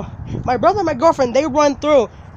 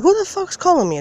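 high, jumpy surprise; startled outburst; 8 semitones higher than usual. Retold or imitated. Narrator startled by a sudden call, high pitched. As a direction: blurt it out startled. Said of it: "I'm like".

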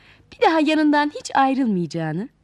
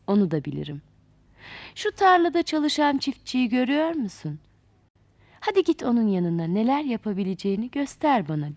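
put-on lower, patient old man correcting a spoiled girl; wise old grandfather; 4 semitones lower than usual. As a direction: speak like a kindly wise elder. Akıl the old man answers.

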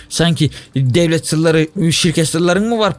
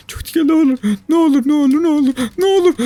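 high, tense pleading; 12 semitones higher than usual. Real, not acted. Gamer pleads nervously while jumping. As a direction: beg the game under your breath. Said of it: "çok dikkatli olmam lazım".

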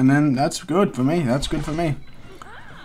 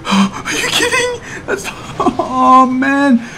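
1 strangled laugh-cry; 12 semitones higher, much slower than usual. Real, not acted. Disbelief after losing, laugh-cry.

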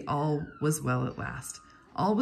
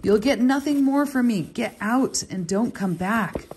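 furious shout; 7 semitones higher, 7 dB louder than usual. A narrator's reading. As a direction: throw him out with a roar. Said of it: "he bellowed".

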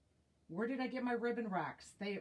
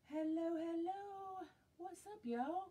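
singsong greeting; 9 semitones higher than usual. Real, not acted. To viewers joining the live stream, a high singsong hello.

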